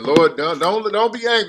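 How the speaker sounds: Abraham pleading not to anger God, hammed-up begging. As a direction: beg like Abraham haggling with the Lord. Voicing Abraham begging the Lord.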